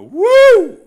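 high, loud joyful whoop; excited whoop. Retold or imitated. Pastor retelling his whoop in the office, ecstatic whoop. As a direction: shout it out like a sudden revelation. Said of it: "all of a sudden I go".

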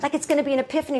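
usual speaking voice

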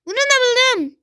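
high, thrilled announcement; excited. Real, not acted. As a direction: crow it out, delighted. Repeating found item, building.

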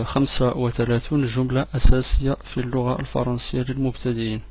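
ordinary talk

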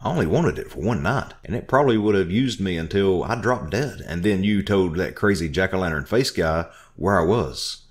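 angry shouting. Acted. Narrator ranting at Orville, angry shouting. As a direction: rail at him.

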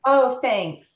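loud, high mock gratitude; sarcastic thanks. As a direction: thank him with pointed sarcasm. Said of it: "my sarcasm was not best".

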